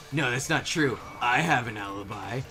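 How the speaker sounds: normal talking voice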